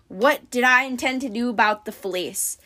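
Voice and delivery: ordinary delivery, nothing marked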